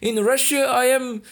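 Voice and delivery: put-on high, loud, slow thick Russian accent; mock a dim Russian engineer in a heavy Russian accent. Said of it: "fala com aquele sotaque russo bué carregado".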